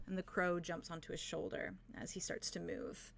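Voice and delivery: ordinary delivery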